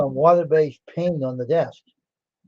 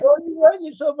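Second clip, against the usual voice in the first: mocking taunt; 12 semitones higher, 4 dB louder than usual. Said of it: "She goes".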